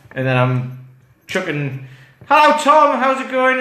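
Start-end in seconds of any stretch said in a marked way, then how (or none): 2.3-3.6 high, loud friendly hello; mimicking a chirpy caller; cheery greeting. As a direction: sing out a bright hello.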